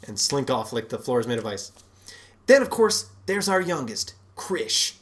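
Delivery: ordinary delivery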